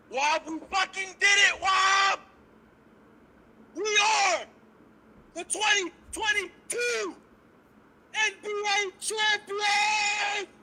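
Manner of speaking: screaming with joy; victory shout. Real, not acted. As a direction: yell it like you just won. Celebrating championship win, shouting.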